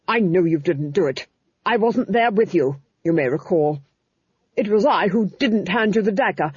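a hint of a cold, commanding duchess; stern duchess. A narrator's reading. Duchess rebuking the Duke about the dagger, cold. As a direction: correct the husband coldly.

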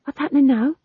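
a touch of alarm in a bewildered question; panicked. A narrator's reading. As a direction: ask it as if caught off guard. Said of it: "He looked around in panic".

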